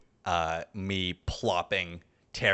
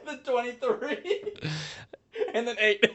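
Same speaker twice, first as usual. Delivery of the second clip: much higher, giggly slow number reading; laughing while counting; slower than usual. Real, not acted. Player to the game master, laughing while reading rolls. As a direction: squeak out the dice totals through laughter.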